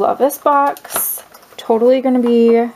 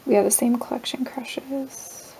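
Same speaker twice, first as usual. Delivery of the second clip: much softer, whispered aside; 9 dB quieter than usual. Real, not acted. Reading a friend's letter, whispered aside. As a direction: confide it in a whisper.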